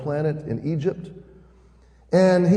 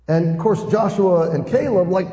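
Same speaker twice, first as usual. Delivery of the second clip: usual speaking voice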